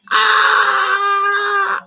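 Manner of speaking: shrill hammed-up climax shout; high mock ecstatic yelp; high mock ecstatic cry. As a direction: let out a very high yelp of release. Acted climax sound.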